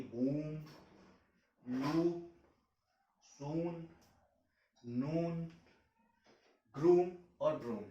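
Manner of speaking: long drawn oo in बूम and लूप; stretched oo. Shown as a demonstration. Teacher shows long oo to students. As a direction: stretch the oo of सून and नून. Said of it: "उसे stretch करके बोला जा रहा है".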